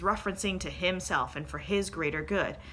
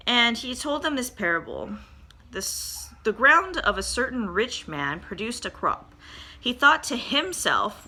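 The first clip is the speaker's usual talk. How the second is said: slow, weighty Bible reading with the voices acted; measured, earnest reading aloud; dramatic scripture reading; 4 dB louder than usual. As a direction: read the parable like a story to the church. Reads the Luke parable aloud.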